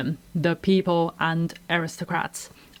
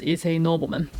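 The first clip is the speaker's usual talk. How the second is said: normal talking voice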